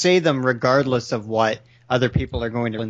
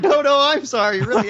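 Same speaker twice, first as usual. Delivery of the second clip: high, laughing, insistent sorry; laughing mock apology; 11 semitones higher, 4 dB louder than usual. Imitating Anthro out-apologising Golden Fox, high and laughing. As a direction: apologise again while cracking up. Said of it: "And then you're like".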